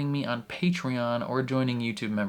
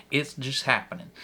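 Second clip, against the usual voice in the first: normal talking voice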